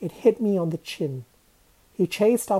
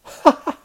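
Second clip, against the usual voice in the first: loud, short burst of laughter; sudden staccato cackle; prankster's laugh; 11 dB louder than usual. Acted. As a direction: laugh out at a successful scare. Prankster laughs at scared friend.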